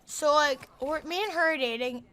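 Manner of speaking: ordinary talk, nothing marked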